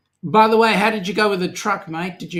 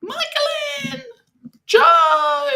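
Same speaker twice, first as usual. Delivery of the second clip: falsetto call-out; 5 dB louder, much slower than usual. Pretend. Calling out to viewers.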